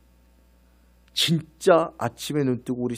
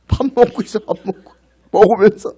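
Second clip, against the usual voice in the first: laughing; 8 semitones higher, 12 dB louder than usual. Real, not acted. Laughing at eating soldiers.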